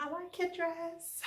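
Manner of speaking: put-on slow, high little-girl voice; high, drawn-out kid impression; imitating little girls. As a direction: coo it like a small child. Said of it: "They're like".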